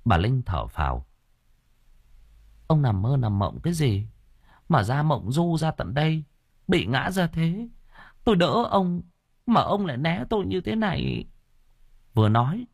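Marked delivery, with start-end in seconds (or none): 2.7-11.2 higher, hurt and near tears; a wife on the edge of crying; tearful wife. A narrator's reading. Wife to husband, hurt and tearful. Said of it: "bà vừa ẩn ẩn nước mắt".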